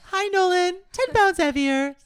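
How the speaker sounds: very high, loud mock greeting; hammed-up announcement. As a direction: call it out in a playful high voice.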